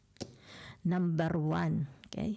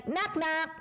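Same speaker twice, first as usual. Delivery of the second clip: much higher, lively joke voice; playful high knock-knock; 12 semitones higher than usual. Pretend. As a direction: sing out the knock-knock like a game. (masayang mataas na boses sa biro)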